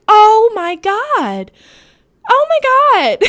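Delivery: high, loud, slow disbelief; shocked. Retold or imitated. Reacting as he steps away from her lean. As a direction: gasp it out in horror.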